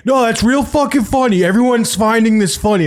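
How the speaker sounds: high, biting sarcasm; mock his bitter sarcasm; sarcastic angry cousin.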